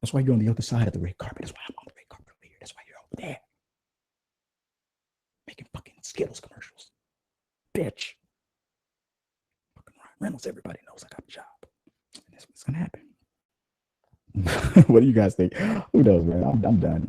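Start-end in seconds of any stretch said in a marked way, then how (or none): 0.0-8.1 whispered taunt. Retold or imitated. Mock Ryan whispering taunt. 9.7-13.0 whisper. Real, not acted. Whispered aside after skit.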